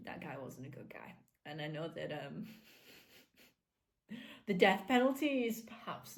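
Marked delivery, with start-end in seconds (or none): 0.1-1.1 whispered aside. Real, not acted. Whispered aside about villain.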